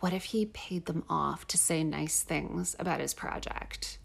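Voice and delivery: low, slow hush of a conspiracy theory. Real, not acted. Wondering aloud to her co-host, hushed and slow. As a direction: lean in and murmur the suspicion.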